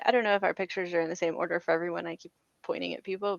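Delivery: normal talking voice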